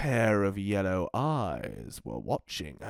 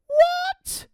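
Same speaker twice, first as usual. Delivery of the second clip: put-on much higher shocked girl cry; sudden dismay; surprised cry; 5 dB louder than usual. Red learns Arthur must come, shocked high cry. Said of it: "cried Red".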